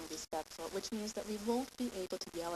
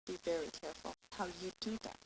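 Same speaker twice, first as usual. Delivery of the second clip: ordinary talk, nothing marked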